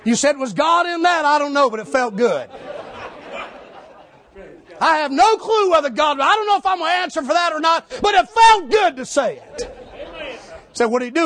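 high loud shouted preaching; preaching at full force. Acted. Street preacher telling the crowd Jesus loves them, shouting. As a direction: drive it home like a street preacher.

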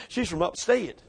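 usual speaking voice

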